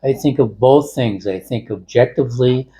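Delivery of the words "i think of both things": usual speaking voice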